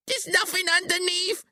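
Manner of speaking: very high, strained shout; panicked shout. A narrator's reading. Billy, trapped in the hole, shouts in panic. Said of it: "he shouts".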